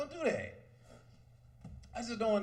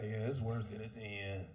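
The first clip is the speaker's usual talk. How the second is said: put-on much lower, softer, resigned old husband; weary married guy; 12 semitones lower, 11 dB quieter than usual. Long-married man telling young guys it's worth it, resigned and low. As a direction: sigh it out like a worn-down husband. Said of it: "telling other young guys like that".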